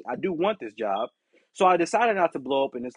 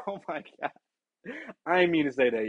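usual speaking voice

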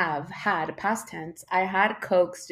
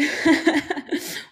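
high burst of laughter; laughing; 9 semitones higher, 6 dB louder than usual. Real, not acted. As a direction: laugh at yourself. Laughs at own yawning.

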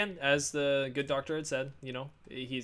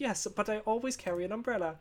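shop woman voice; 7 semitones higher than usual. Retold or imitated. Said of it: "She's like".

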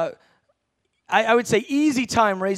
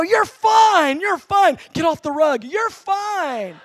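forced reassurance; 7 semitones higher, 4 dB louder, slower than usual. Retold or imitated. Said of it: "I go".

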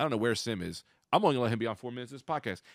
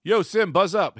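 calling out; 7 semitones higher, 8 dB louder, slower than usual. Real, not acted. Calling to friend at door.

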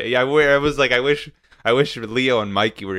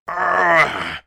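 frustrated roar, higher and louder; angry roar; 7 semitones higher, 4 dB louder than usual. Acted. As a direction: let out a furious roar. Clenches turtle fists in rage.

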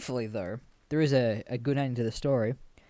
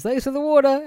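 much higher, louder, sing-song thanks; cheerful parting line; hammed-up high salesman voice; chirpy thanks; 13 semitones higher, 8 dB louder than usual. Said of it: "And he just goes".

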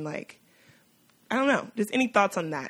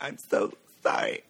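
mock tearful apology; 12 semitones lower than usual. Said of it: "she's, like, crying".

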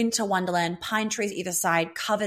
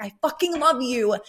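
higher-pitched imitation of an emphatic partner; emphatic love declaration; 6 semitones higher than usual. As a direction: blurt it out higher, acting out his declaration. Acting out his playful declaration.

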